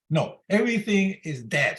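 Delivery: ordinary delivery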